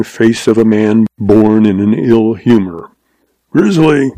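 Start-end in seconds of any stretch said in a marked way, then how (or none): 3.5-4.1 higher-pitched, lightly snapped call. Acted. Old boss calls his ramrod over, snapping. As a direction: call the name with a touch of snap. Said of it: "snapped old Goodnight".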